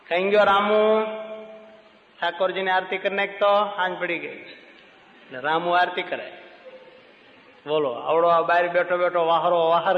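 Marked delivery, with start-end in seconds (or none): 0.1-4.4 raised, higher call to the servant; a wealthy man shouting orders across the house. Retold or imitated. Rich man calling servant Ramu.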